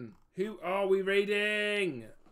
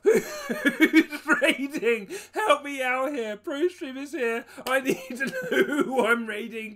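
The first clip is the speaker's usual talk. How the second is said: laughing; 7 semitones higher, 8 dB louder than usual. Real, not acted. Laughing, pleading with chat.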